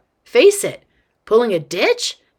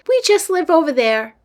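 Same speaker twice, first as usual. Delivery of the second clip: put-on higher-pitched child voice; chatty little girl; 8 semitones higher, 3 dB louder than usual. Girl points out her house, chatty kid voice. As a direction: pipe up like a friendly kid.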